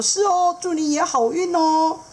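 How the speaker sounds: high bright good-luck reply; hook fish dad. Acted. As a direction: sing it back warmly high. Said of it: "钩鱼爸爸也说".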